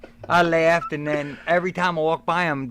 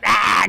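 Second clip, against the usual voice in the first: loud put-on yell; hammed-up battle cry; mock wrestler roar; 5 semitones higher, 6 dB louder than usual. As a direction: roar the entrance.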